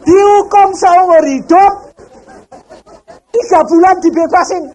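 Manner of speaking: high, loud disbelief; amazed. Real, not acted. Preacher marvelling to the congregation, high and loud. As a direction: throw it out to the congregation in astonishment.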